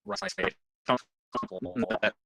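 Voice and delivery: glitching call audio; choppy broken connection; wobbly warbling call audio. Real, not acted. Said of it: "you are glitching out so bad".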